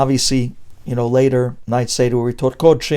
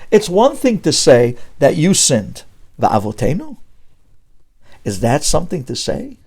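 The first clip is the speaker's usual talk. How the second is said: ordinary talk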